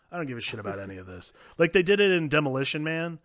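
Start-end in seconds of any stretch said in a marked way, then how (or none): 0.1-1.3 low, quiet, fast throwaway; bored dismissive. Retold or imitated. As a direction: rattle it off, not caring. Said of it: "And I'm like".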